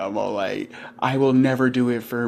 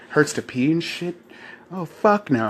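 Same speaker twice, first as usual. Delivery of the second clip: ordinary delivery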